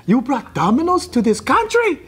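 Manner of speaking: high-pitched, hammed-up Italian indignation; mock Italian outrage. Italy taking its food seriously, mock Italian outrage. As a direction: accuse them as an offended Italian. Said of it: "because Italy takes their food so serious".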